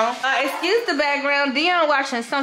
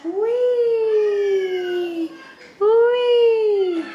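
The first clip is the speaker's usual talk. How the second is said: playful wee; 8 semitones higher than usual. Real, not acted. Mom playfully feeding baby.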